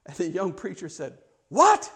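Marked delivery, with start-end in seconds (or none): 1.5-1.9 high, loud startled "What?"; incredulous outburst. Retold or imitated. Young preacher thrown by Jim's question, incredulous. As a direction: burst out in disbelief.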